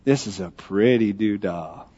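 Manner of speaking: low, slow, hushed little-boy imitation; awed toddler. Dad imitating his son admiring the church, slow and hushed. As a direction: draw it out softly, like a child taking in a church. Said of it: "And he says".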